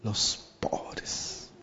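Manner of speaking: softly, almost breathed, slowing down; hushed. Acted. Preacher hushing the beatitude to the congregation, whispered. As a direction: sink to a near whisper for the beatitude.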